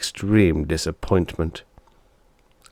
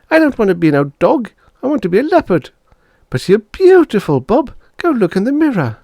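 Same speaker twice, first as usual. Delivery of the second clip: put-on high, loud kids' squabble; children quarrelling over the dog; child voices; 6 dB louder than usual. Siblings arguing, high loud voices.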